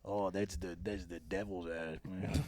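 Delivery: hushed. Acted. Guillermo lewd monster talk.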